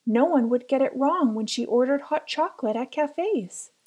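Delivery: plain reading voice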